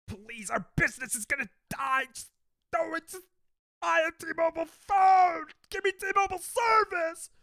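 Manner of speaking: high, loud, hammed-up panic that builds to a squeal; mock desperate begging. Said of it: "really focusing on".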